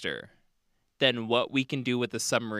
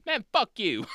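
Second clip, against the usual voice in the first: a bad Australian accent, higher; a blunt Aussie, hammed up; 8 semitones higher than usual. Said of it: "there will be bad Australian accents".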